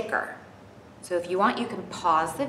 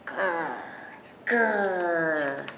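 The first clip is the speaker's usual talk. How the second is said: tiger growl; 2 semitones lower than usual. Shown as a demonstration. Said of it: "don't forget to growl".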